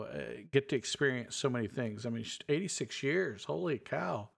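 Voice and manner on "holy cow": usual speaking voice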